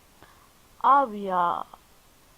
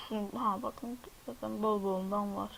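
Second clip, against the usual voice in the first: ordinary delivery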